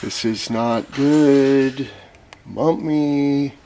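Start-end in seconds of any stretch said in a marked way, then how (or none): none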